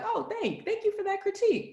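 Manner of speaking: high, sugary, laughing thanks; mock polite thanks. To a critic on the committee, sugary mock politeness. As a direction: sweetly fake gratitude.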